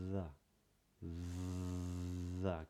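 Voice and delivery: "the" with a long, buzzing voiced TH. Shown as a demonstration. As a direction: stretch the TH in "the". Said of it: "Can you hear it?".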